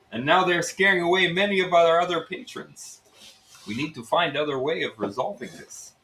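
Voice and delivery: Russian innkeeper coaxing them into a scheme; Russian accent. Acted. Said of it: "it's Russian".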